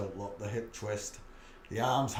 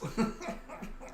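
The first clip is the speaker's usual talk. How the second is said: laugh. Real, not acted. Laughing at own Leeds story.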